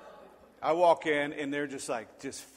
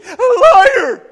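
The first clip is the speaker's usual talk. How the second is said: much louder, higher furious yell; shouting liar; 20 dB louder than usual. Retold or imitated. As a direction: hurl the accusation back. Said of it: "and this is what I said".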